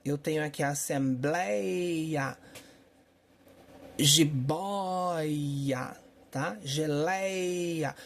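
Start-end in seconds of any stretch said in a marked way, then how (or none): 0.6-6.0 assembleia, jiboia with long open éi and ói; open diphthongs. Shown as a demonstration. Teacher showing unaccented words with open diphthongs, heavily stretched. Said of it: "ditongos abertos em paroxítonas". 6.6-7.9 geleia with a long open éi; open diphthong. Shown as a demonstration. Teacher giving a further example, stretched open diphthong. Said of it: "com ditongo aberto".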